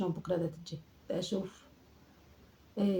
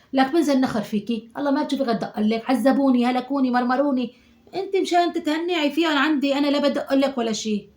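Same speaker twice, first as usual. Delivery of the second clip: louder and higher rant, peaking high; exasperated scolding; 5 semitones higher, 5 dB louder than usual. Acted. Grandmother ranting at her daughter about the unruly boys, exasperated scolding. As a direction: vent like a fed-up grandmother.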